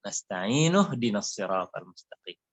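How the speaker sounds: slow "Nasta'inu", its u running into "dinas siratal mustaqim"; joined with dhammah. Shown as a demonstration. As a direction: link "Nasta'inu" to the next verse without stopping. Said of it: "barisnya dhammah". ("Nasta'inu dinas" disambung dengan bunyi u)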